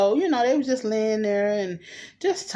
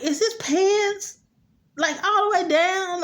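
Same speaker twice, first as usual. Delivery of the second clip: mock appalled surprise; 9 semitones higher than usual. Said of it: "I was like".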